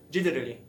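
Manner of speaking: "digitally" slurred into didgerly; imitating the show. Learner quoting the line from the show, run-together pronunciation. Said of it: "Ele falava".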